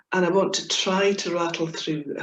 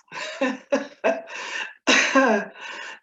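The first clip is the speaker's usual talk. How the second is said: breathy laugh; 6 semitones higher than usual. Real, not acted. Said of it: "fits of laughter".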